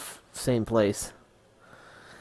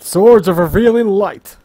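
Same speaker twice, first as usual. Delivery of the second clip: dramatic card call; 8 semitones higher, 11 dB louder, slower than usual. Pretend. Yu-Gi-Oh card declaration.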